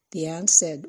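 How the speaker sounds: ordinary delivery, nothing marked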